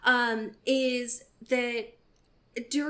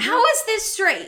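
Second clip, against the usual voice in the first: outraged disbelief; 9 semitones higher, 7 dB louder than usual. Real, not acted. Outraged rhetorical question.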